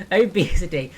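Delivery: laughing out the word Obesity; Obesity said through a laugh. Real, not acted. Laughing at joke.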